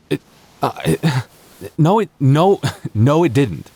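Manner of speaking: high, loud, stumbling no with a nervous laugh; flustered denial. Acted. Caught by his crush, a flustered laughing denial.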